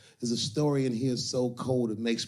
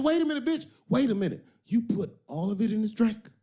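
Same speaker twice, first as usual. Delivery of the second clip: much higher, louder hammed-up alarm; mock alarm; 11 semitones higher, 3 dB louder than usual. Pimp realizing she used all of it, mock alarm. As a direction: shoot way up in disbelief. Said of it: "He said".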